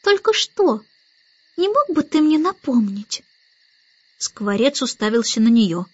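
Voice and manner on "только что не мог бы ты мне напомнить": put-on high little-girl voice, questioning; puzzled baby Annabel. A narrator's reading. Baby Annabel asks the starling for help, high and puzzled. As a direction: ask it high, like a small child.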